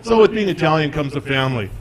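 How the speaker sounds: ordinary talk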